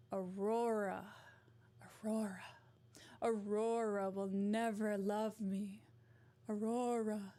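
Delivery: theatrical evil queen, unhurried; Maleficent. Acted. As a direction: brood on each word like a villainess. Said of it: "I could do a better evil villain".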